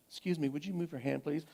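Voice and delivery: clipped hushed courtesy; quoting daughter's tense politeness. Retold or imitated. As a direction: imitate the daughter forcing politeness through anger.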